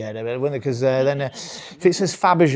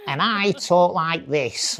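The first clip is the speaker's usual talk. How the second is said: slow, exaggerated British voice; very British accent; 3 semitones higher, 3 dB louder, much slower than usual. Shown as a demonstration. Son contrasting himself with Spanish father, exaggerated British accent. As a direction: overplay your own British accent, drawn out. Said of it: "I know I sound very, very British".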